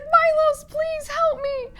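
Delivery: high, slowed pleading cry; panicked plea. Retold or imitated. Odette crying out to Milos from the dungeon, high panicked plea. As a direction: beg him to save you.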